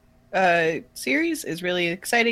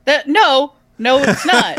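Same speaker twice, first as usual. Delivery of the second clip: loud protest; 6 semitones higher, 7 dB louder, slower than usual. Real, not acted. Protesting blame for the voice.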